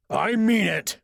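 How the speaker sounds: roared warning. Acted. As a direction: bellow it so nobody doubts him. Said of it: "Professor Warren roared".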